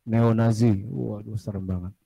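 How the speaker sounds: ordinary delivery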